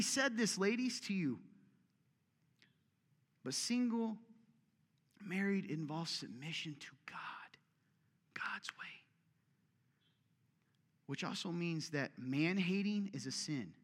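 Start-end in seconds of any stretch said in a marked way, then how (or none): 5.2-8.8 slow hushed whisper; slow whisper for emphasis. Real, not acted. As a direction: lean in and breathe it. Hushed aside on submission.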